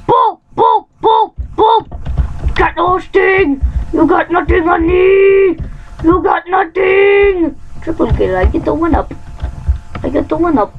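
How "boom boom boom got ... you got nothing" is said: high cocky taunt; taunting shout. Real, not acted. Taunting enemies in game.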